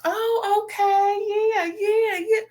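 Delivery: high, breathy mock-breezy Letitia voice; squeaky fake-agreeable brush-off; imitating Letitia. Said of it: "Letitia's just".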